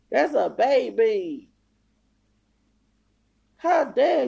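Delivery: high, drawn-out, outraged yelling; furious scolding. Real, not acted. Berating a woman for insulting a child, furious.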